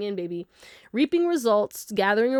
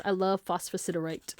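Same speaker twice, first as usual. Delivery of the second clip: ordinary delivery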